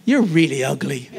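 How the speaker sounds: sugar-coat the jab; mocking a fake loving rebuke to Gareth; mock sweet insult. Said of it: "that's not done in love".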